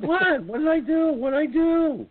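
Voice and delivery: high, loud mock-innocent protest; innocent what. Son to laughing poll ladies, mock-innocent high protest. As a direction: play dumb, all high innocence. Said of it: "I said".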